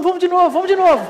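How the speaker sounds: bem agudo e mais alto, rindo de alegria; gritinho eufórico rindo. Retold or imitated. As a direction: pede de novo rindo, como criança empolgada. Euforia após pousar. (criança eufórica rindo após o pouso)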